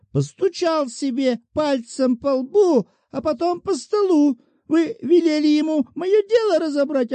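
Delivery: a whining widow threatening with her rights; defenceless petitioner. A narrator's reading. Shchukina voiced by narrator.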